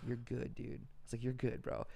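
soothing friend. Retold or imitated. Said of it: "I see him starting to unravel".

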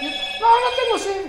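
high, slow, hammed-up denial; mock refusal. Man offered water, hammed-up refusal. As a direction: play it high and drawn out.

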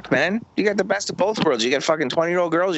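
ordinary delivery

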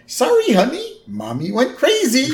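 high, singsong put-on voice for a baby; high-pitched cartoon dad; Homer. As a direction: break bad news in mock baby talk. Acting out Homer talking to baby.

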